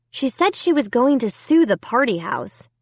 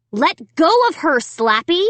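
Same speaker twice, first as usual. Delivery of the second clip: demanding; 6 semitones higher than usual. A narrator's reading. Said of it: "I demanded".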